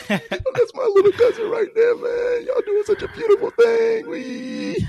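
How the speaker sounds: very high, loud sob-laughing gush; blubbering cousin. Retold or imitated. Drunk cousin crying over his little cousin, very high sobbing. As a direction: wail the praise through drunken tears, way up high. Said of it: "He's like".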